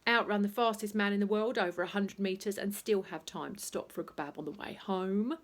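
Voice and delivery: ordinary delivery, nothing marked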